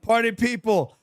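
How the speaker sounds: ring-announcer shout, pitched high; hype announcer. Pretend. Host welcomes attorney to the show, big announcer shout. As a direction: whip up the crowd for a grand entrance.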